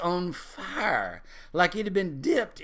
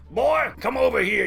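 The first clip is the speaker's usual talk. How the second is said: put-on higher, louder call to the boy; 8 semitones higher, 6 dB louder than usual. Daddy Price calling grandson over, firm call. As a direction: summon the boy firmly.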